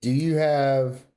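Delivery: normal talking voice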